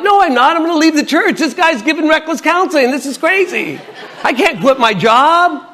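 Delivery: high-pitched comic protest; acted-out panicky doubt. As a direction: talk yourself out of it, voice way up. Acts out his sudden second thoughts.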